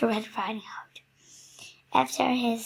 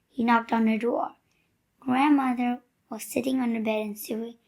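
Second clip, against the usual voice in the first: usual speaking voice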